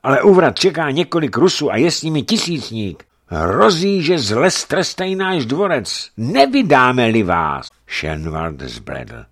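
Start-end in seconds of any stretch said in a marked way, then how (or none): 0.0-7.7 high, loud, urgent warning; breathless alarm. A narrator's reading. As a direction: blurt out the bad news in alarm. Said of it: "dlouho nemohl popadnout dech".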